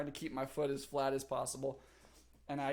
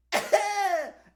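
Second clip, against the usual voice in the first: high, loud laugh; burst of laughter; 11 dB louder than usual. Real, not acted. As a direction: laugh at own bad luck. Laughing at the couch mishap.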